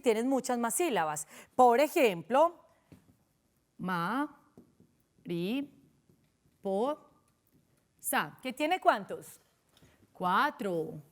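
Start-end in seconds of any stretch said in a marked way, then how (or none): 3.8-8.4 "ma, ri, po, sa" as isolated beats with long pauses; split into syllables. Shown as a demonstration. Teacher counting out a long word, "ma, ri, po, sa" syllable by syllable. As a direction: leave a gap after each of "ma, ri, po, sa". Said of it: "tienen más de tres sílabas".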